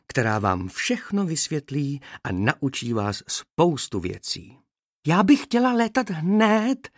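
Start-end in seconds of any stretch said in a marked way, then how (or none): 5.1-6.9 high, stubborn demand, slow and louder; insistent little bee. A narrator's reading. Little bee won't be put off by the trainer, insistent and high. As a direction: insist on it in a much higher voice. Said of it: "nenechávala se odbít ta malá".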